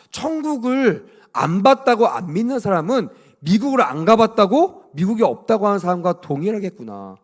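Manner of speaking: usual speaking voice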